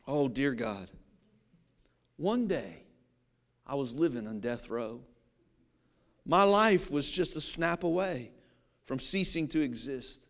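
low, hushed, reverent mealtime prayer; dog's solemn prayer. Pretend. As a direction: pray softly and gratefully like a humble rescued dog. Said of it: "say something like this".

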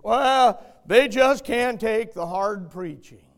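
loud, slightly hammed-up excuse; mock excuse-making. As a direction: voice a churchgoer's excuse, loudly. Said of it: "We make excuses".

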